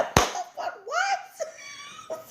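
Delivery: laughing shock. Retold or imitated. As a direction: shriek with laughter in disbelief. Shocked at his revelation.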